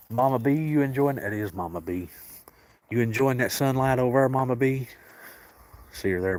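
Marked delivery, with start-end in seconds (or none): 0.1-1.2 affectionate high call to a pet; pet talk. Real, not acted. Calling to a cat in sunlight. 2.9-4.9 affectionate high call to a pet; pet talk. Real, not acted. Calling to a cat in sunlight.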